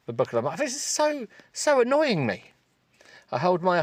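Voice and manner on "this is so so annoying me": mock exasperation. Reading mock-frustrated book line.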